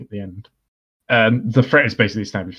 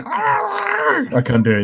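very high, slow warbling trill; weird trill; much slower than usual. Retold or imitated. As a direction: trill it like Zoidberg. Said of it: "she does that really weird".

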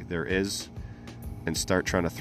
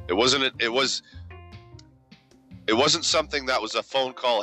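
higher, upbeat sponsor pitch; sponsor announcer; 5 semitones higher than usual. Acted. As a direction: roll it out like a hype announcer. Hyped sponsor read segment.

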